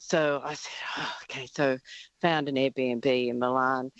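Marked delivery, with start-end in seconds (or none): 1.0-1.5 soft, clipped "Oh, okay" through suppressed fury; mother holding back fury at her son, quietly; tight-lipped okay. Retold or imitated. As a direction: swallow the anger, say it quietly. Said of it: "I had steam absolutely coming out my ears".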